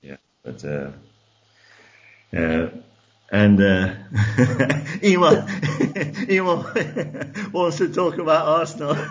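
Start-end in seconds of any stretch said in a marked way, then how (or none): 4.1-9.0 laughing through the words; giggling while teasing. Real, not acted.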